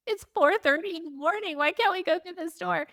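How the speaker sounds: high, whiny complaint; exasperated whine. Retold or imitated. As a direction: plead at the locked door.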